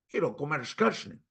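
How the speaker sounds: "comer carne" with a hushing sh after r; dialect pronunciation. Shown as a demonstration. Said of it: "¿El dialecto fonético qué es? Pronunciación".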